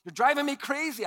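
put-on a parent fed up with the kids; mock parent yell. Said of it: "I would sometimes yell at my kids".

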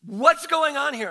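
a father indignant at the delay; impatient father. Retold or imitated. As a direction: voice Jairus getting fed up. Voicing Jairus's impatience.

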